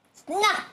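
high sharp snap; fox snap. A narrator's reading. Fox bites the gingerbread man, a sharp high snap. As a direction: snap the jaws shut. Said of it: "went the fox".

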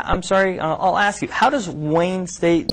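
usual speaking voice

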